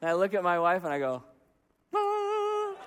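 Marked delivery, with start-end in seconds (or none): 1.9-2.7 high, sustained "ahh" of self-display; showing-off "ah". Retold or imitated. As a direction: softly sing out a proud ta-da sound. Showing off chore to wife.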